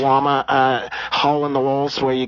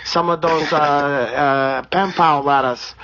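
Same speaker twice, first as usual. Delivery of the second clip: put-on Brazilian accent; 3 semitones higher than usual. Comedian holding a put-on Brazilian accent.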